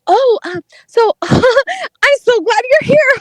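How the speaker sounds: high, slow, giggly nerd imitation; imitated awkward nerd. Mocking the nerdy date welcoming her, awkwardly eager. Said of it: "He was a n-, like, a nerd".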